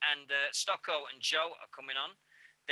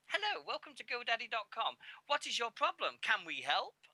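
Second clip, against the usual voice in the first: lightly put-on American customer service voice, higher; a hint of a chirpy American call-centre greeting; American accent; 8 semitones higher than usual. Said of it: "In an American accent".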